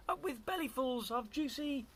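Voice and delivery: high chant left open at the end; hooting cheer. A narrator's reading. Duckbills chant, child fills in the word, high hooting. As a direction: hoot it high for the child to finish. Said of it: "And they hooted".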